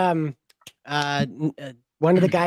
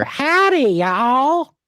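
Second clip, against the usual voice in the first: high hammed-up Southern howdy, louder; mock Southern drawl; 10 semitones higher, 5 dB louder, much slower than usual. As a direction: play the cowboy for the Brits. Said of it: "has a fetish for".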